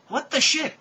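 high, loud startled shout. Acted. Heroine arriving in the empty city, shouting high and loud. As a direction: shout it in shock. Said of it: "I shouted".

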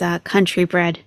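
usual speaking voice

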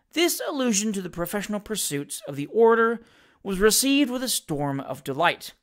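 plain reading voice

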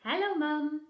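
put-on high, loud child voice; child's greeting. Bernard calling hello to his mother. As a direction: call out like an eager boy.

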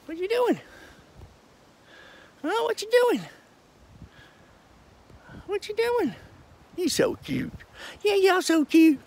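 squeaky high voice for the goats; high pet talk. Real, not acted. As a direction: coo up high like talking to babies. Cooing at his goats.

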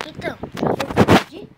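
loud high call across the house. Pretend. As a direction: shout it out to someone far away. Calling out to visitor.

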